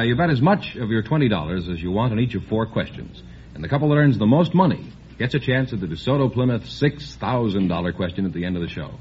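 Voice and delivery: normal talking voice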